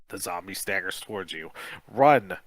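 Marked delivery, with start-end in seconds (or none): 1.9-2.4 loud, sharp command; urgent order. A narrator's reading. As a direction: bark it to get her moving. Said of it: "you order Kim".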